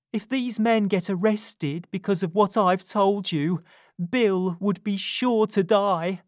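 urgent Nancy. A narrator's reading. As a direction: confess it as if your life depends on it. Nancy explaining.

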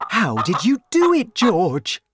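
loud, high amazed question; father's high, excited voice; excited cry. A narrator's reading. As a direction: ask it loudly, bursting with wonder. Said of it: "he cried".

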